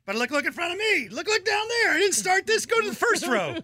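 high-pitched mock pleading; defensive fan. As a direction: protest in a high voice, passing the blame. Acting out standing fan's excuse.

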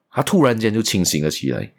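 ordinary talk, nothing marked

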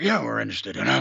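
low, fast slurred mumble; slurred impression. Mocking how the boss talks now, low slur. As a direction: run it together low, mocking an ailing speaker. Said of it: "now he's like".

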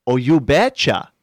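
put-on Fargo-style Wisconsin accent, punched up. Fellow actor showing how the catchphrase sounds, Wisconsin accent. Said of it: "say it in a Wisconsin accent".

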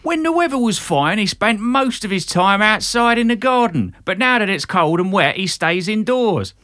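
hammed-up Britpop South London; really [name] it up, loud and high; South London accent. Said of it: "really [name] it up".

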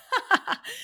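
a short high burst of laughter; high giggle. Real, not acted. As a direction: let a giggle escape.

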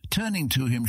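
ordinary delivery

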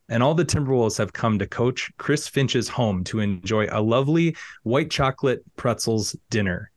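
usual speaking voice

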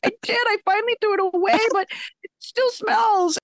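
high, whiny imitation of a daughter; hammed-up high wail, dragging out the end; upset daughter. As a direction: protest like an exasperated preteen. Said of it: "really upset".

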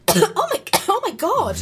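high, loud astonished cry; stunned by what she sees; shocked gasp. Acted. Shocked at the mysterious object.

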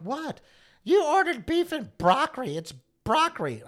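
high, loud, slow exasperation; hammed-up indignant voice; mock incredulous quote. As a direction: drag it out in mock disbelief. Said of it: "I'm like".

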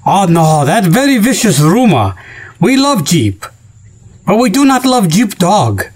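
hammed-up broken-English Chinese accent; mock Chinese accent. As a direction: deny the rumor in a put-on Chinese accent.

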